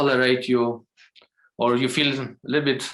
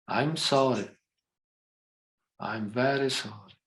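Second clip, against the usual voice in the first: guided meditation; 3 dB quieter than usual. Acted. Teacher guiding the group into their hearts, soft meditation voice. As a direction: lead them gently inward, line by line.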